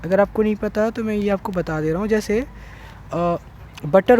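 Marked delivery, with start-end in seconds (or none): none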